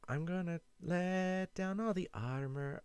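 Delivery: lightly sung Irish accent attempt; a weak try at an Irish lilt, sung high. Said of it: "I can't do the Irish accent".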